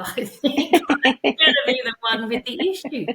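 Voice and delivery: amused self-mockery, voice lifted; laughing admission. Real, not acted. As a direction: laugh at yourself as you say it. Laughs at her own admission.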